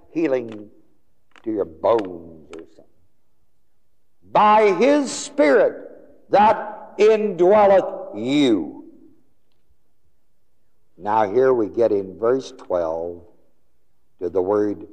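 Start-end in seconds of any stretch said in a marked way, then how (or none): 4.3-8.9 high, slow, ringing scripture climax; fervent proclamation. Real, not acted. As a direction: draw each word out in a high preaching cry. Preacher proclaims the verse climax.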